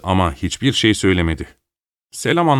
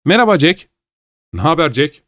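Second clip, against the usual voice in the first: high, lively hail; called greeting; 7 semitones higher, 3 dB louder than usual. A narrator's reading. Brothers greeting Jack at his arrival, calling out. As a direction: hail a friend as he enters. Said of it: "diye seslendi Malcolm'la Joe".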